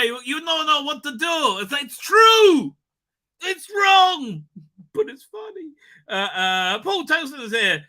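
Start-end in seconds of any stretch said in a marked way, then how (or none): 0.0-5.7 high, loud excited shout; excited outburst. Real, not acted. Streamer still giddy from laughing, shouting it out.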